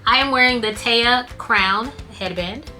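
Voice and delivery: usual speaking voice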